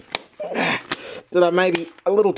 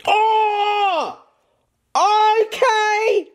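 high startled gasp of disgust; disgusted shock; 13 semitones higher, much slower than usual. Real, not acted. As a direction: recoil from a foul smell. Reacting to putrid smell.

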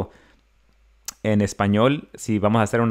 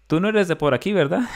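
higher knowing tease; taco server teasing; 8 semitones higher than usual. Retold or imitated. Taco girl catching the foreigner out, higher and teasing. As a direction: catch him out with a grin. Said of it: "la muchacha que lo estaba sirviendo me dijo".

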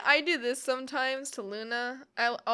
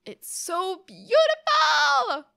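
high, loud, slow gush with a laugh; 12 semitones higher, 10 dB louder, much slower than usual. Real, not acted. Viewer gushing over the home's beauty, high and loud. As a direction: swoon over the pretty scene.

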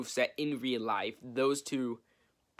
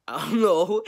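laughing; 8 semitones higher, 7 dB louder, slower than usual. Real, not acted. Laughs after saying he'd go crazy.

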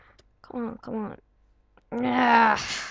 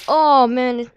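normal talking voice

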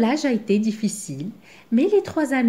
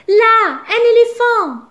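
much higher, louder, slow thrilled call; excited exclaim; 12 semitones higher, 7 dB louder, slower than usual. A narrator's reading. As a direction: point it out with wonder. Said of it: "s'exclame Zigomar".

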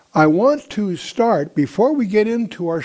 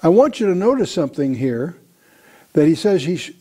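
ordinary delivery

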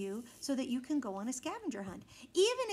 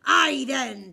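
much louder pirate bellow; 12 dB louder than usual. A narrator's reading. Pirate captain agreeing loudly. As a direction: boom it out. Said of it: "bellowed Braidbeard".

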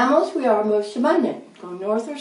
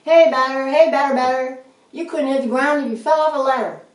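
loud, higher-pitched ballpark taunt; mock heckling yell; 4 semitones higher, 6 dB louder than usual. A narrator's reading. Rabbit team taunts the rat's basketball team, heckling yell. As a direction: jeer at the batter like a rowdy rival team. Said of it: "They yelled at us".

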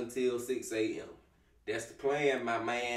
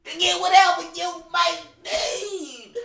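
high, loud, slow announcer shout; hammed-up loud announcer line; booming announcer; 8 dB louder than usual. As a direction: proclaim it like a game announcer. Mocking game's announcement voice.

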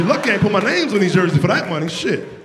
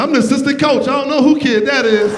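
loud denial; 6 semitones higher, 6 dB louder than usual. Retold or imitated. Dad denying his son.

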